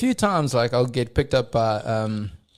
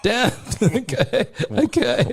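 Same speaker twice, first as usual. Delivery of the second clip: laughing; 7 semitones higher, slower than usual. Retold or imitated. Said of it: "And I was like".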